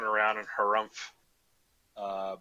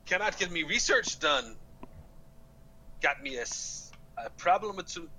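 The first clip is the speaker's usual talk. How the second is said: higher-pitched, hammed-up Scottish burr; horrible Scottish accent; 6 semitones higher than usual. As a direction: complain in a put-on Scottish accent. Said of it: "back to my horrible Scottish accent".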